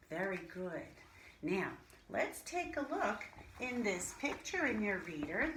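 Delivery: normal talking voice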